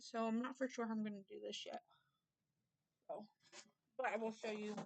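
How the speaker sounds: talking through a mouthful of food; chewing while speaking, mouth full. Real, not acted. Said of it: "Sorry, I'm talking with my mouth full".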